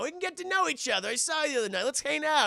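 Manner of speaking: a lightly voiced sneering vampire; vampire baiting the new kid into a race; mock friendly invite. Vampire mockingly inviting Michael.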